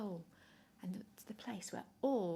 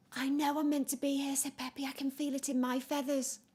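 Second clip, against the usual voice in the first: higher, louder joyful penguin; elated; 6 semitones higher, 5 dB louder than usual. Acted. Peppy arriving at the North Pole, elated. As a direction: sure and thrilled.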